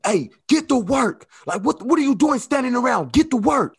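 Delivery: high, loud mock boss yell; imitating a yelling boss. Boss yelling at idle employee, high loud mock yell. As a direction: bark it like a boss who's fed up with idle workers. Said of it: "when your boss yell at you".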